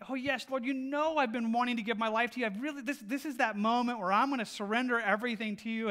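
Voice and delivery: man dangling from a root, pleading loudly; desperate prayer. Acted. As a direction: beg for rescue while hanging off a cliff. Man begging God in fable.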